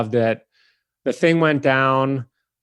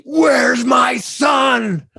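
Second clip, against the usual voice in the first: very high, loud, slow shouted demand; yelling; 12 semitones higher, 4 dB louder, much slower than usual. Retold or imitated. Actress erupts at the clerk in the retake, yelling. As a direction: explode at him, dragging out every word. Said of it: "she starts yelling at me".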